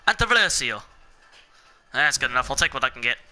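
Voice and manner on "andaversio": attempted Italian accent on the car name. Shown as a demonstration. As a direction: put on a light Italian accent. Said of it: "let me say that in my Italian accent".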